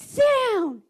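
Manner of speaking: high, loud command; drawn-out teacher's shout; retold yell. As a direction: yell an unruly class into their seats. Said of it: "I just, I yelled at".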